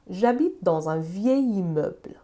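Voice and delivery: Je travaille sur un grand bureau, read slowly word by word; slow French example. Shown as a demonstration. Teacher reads the French sentence slowly for learners. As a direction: spell it out slowly for the class. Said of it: "مثال بزنیم".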